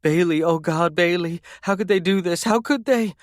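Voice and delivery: high, wailing cry of disbelief; terrified mother sobbing out a question; frantic wailing. A narrator's reading. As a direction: wail it out in terror for your child. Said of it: "this frantic terror bleeding from her lips".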